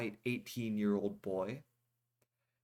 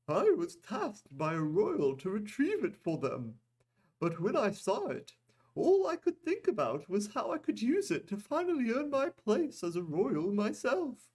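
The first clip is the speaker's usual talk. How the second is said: Goldak voice; 12 semitones higher, 4 dB louder than usual. A narrator's reading. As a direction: raise the pitch for the nervous merchant. Goldak's voice in the story.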